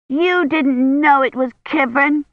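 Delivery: put-on high-pitched little girl protesting; indignant mild shout. A narrator's reading. Child disputes who found Kivrin, mild shout. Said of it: "Agnes shouted".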